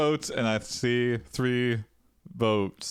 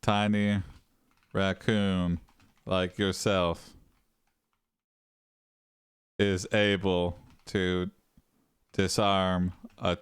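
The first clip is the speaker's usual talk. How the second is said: low, halting narration with long gaps; slow, soft, word-by-word delivery; slow dictation; 5 semitones lower, 4 dB quieter, slower than usual. Real, not acted. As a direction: dictate it piece by piece as if typing. Dictates the story prompt while typing.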